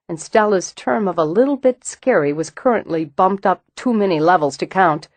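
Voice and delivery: plain reading voice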